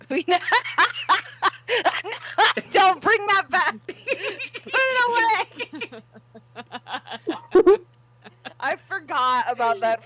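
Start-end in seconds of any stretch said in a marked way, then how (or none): 0.1-7.8 high squealing laugh through words. Real, not acted. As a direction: laugh helplessly while protesting. Embarrassed by old picture.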